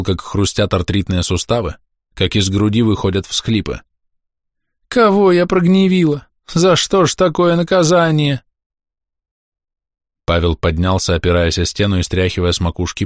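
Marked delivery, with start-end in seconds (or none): 4.9-8.4 high-pitched plaintive wailing; tearful lament. A narrator's reading. Old woman asks heaven why she is punished, tearful lament. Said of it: "плаксиво причитала бабка".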